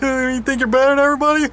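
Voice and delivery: high, fast put-on accusation; mocking sneer. Roommate accusing the speaker, fast high mocking voice. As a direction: rattle it off in a whiny high voice. Mocked reply in retold quarrel.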